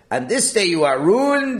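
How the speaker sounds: "this day" pitched high and loud; stress on THIS DAY. Shown as a demonstration. Teacher answering his own question, loudly stressing "this day". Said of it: "Why do you emphasize the word this day?".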